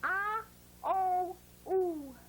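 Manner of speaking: "ah", "oh", "ooh", each held separately; three distinct vowels with pauses between; phonogram sounds. Shown as a demonstration. Teacher modelling the sounds of O, separated vowels. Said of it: "This phonogram says".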